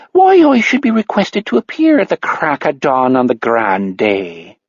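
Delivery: coy Irish brogue, playing simple; hammed-up stage Irish accent. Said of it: "dropping the stage Irish accent".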